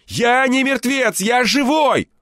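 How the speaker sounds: high, loud desperate plea; urgent high-pitched shout. A narrator's reading. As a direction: yell it so someone outside hears you. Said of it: "закричал я".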